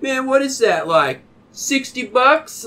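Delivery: high-pitched needling, slowing on the price; mock teasing. Stranger joking about the Abercrombie hoodie, teasing. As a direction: rib him about his clothes, voice up.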